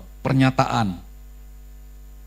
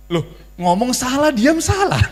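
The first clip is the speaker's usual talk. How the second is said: high and laughing, wrong either way; laughing exasperation; 10 semitones higher, 4 dB louder than usual. Real, not acted. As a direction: chuckle through the complaint, pitched up. Preacher jokes about husbands' dilemma. (tertawa kesal dengan suara tinggi)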